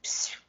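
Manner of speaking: soft, voiceless shush; a brief quiet hush; hushing psst. Acted. Mafaldinha hushes Felipe, someone is ill at home, soft psst. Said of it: "ela tá falando mais baixo do que ele".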